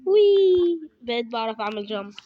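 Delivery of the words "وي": high, loud "وي" of delight; gleeful jump cry; excited whoop. Real, not acted. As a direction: let out a joyful whee on the jump. Jumping in the game.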